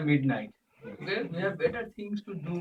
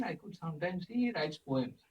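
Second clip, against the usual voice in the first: usual speaking voice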